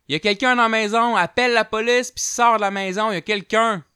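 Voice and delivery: high, loud, fast warning; yelling to get them out now; urgent shout. Retold or imitated. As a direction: bellow the alarm. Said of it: "il crie".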